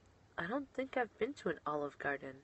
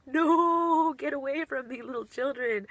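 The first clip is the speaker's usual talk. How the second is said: mock alarm; 8 semitones higher, 7 dB louder than usual. Teacher fending off swarming kindergartners.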